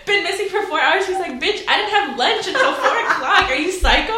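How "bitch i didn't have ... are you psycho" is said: high, loud, incredulous comeback; hammed-up exasperation; mock indignation. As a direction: reenact an offended reply, half laughing. Imitates annoyed missing person replying.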